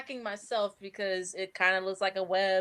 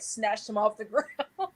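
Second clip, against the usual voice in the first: usual speaking voice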